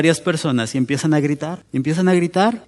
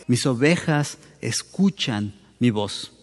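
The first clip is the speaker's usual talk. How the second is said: soft solemn quote; 4 semitones lower, 5 dB quieter, slower than usual. Shown as a demonstration. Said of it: "la implicación de lo que Jesús está diciendo es".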